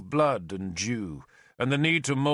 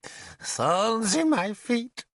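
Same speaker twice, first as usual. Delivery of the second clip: high, slow, pained whimper; 11 semitones higher, slower than usual. Acted. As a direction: cry out the pain of his feet.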